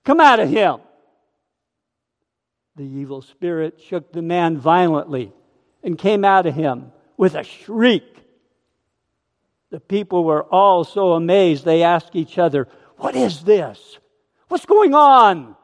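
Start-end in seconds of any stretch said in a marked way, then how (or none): 0.0-0.8 loud, high rebuke; Jesus ordering the spirit out; stern command. Acted. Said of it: "said Jesus sternly". 13.0-15.5 amazed crowd. Acted. Amazed crowd asking each other.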